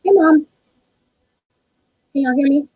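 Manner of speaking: ordinary talk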